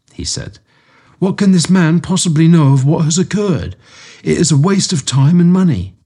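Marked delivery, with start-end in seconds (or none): 1.2-6.0 higher, scoffing miser voice; Amberley. A narrator's reading. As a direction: sneer it off as a waste of money. Client scoffs at the telegram.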